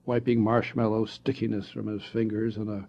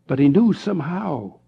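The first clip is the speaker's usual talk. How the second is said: ordinary delivery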